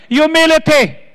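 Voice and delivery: normal talking voice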